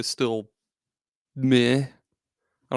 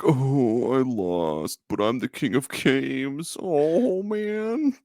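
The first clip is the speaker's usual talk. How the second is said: Yugi; much slower than usual. Acted. Host mocking anime hero's depression after losing, whiny lament. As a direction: wail it in hammed-up self-pity, drawn out. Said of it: "And Yugi's like".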